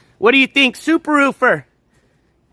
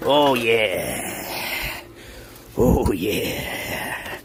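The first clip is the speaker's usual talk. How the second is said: low savoring groan; 13 semitones lower, 8 dB quieter, much slower than usual. Real, not acted. Savoring found food.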